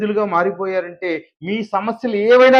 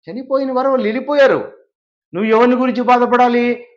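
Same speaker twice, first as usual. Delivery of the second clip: high, forceful sermon exhortation; impassioned preaching; 8 semitones higher, 4 dB louder than usual. Acted. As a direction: preach it hard, pitched high and held up there. Preaches verse at full force.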